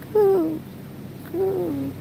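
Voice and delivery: high, soft drawn-out bird coo; dove coo. Retold or imitated. Storyteller imitating the sweet dove on the railing, cooing.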